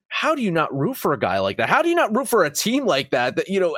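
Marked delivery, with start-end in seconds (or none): 1.7-3.4 rising passion. Real, not acted. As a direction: push the second ask higher with real admiration. Repeated rhetorical question, rising passion.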